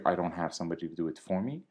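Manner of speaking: ordinary talk, nothing marked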